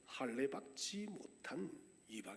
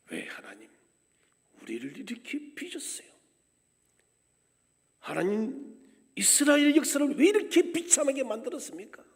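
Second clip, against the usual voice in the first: higher, much louder fast outcry; loud protest to God; 5 semitones higher, 12 dB louder than usual. Retold or imitated. Exiles lamenting their history before God, loud fast outcry. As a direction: hurl the complaint at God. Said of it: "하나님 앞에 항변하고".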